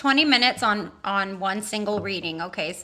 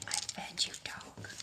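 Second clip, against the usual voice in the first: whisper; 18 dB quieter, slower than usual. Real, not acted. Whispering to the dog.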